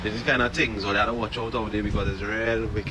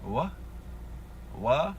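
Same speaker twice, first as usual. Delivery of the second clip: surprised disbelief; 6 semitones higher, 5 dB quieter, slower than usual. Retold or imitated. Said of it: "And I was like".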